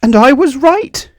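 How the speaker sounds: building to a high, loud cry of triumph; rising with delight to the last words; exclaimed. A narrator's reading. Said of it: "he exclaimed".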